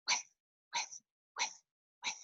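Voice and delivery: voiceless puffs of air; breathy sprinkler whiffs. Retold or imitated. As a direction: puff each whiff out. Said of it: "They go like this".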